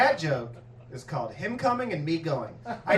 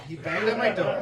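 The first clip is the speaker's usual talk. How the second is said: said through a laugh. Real, not acted. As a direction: repeat it cracking up. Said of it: "I have a witness".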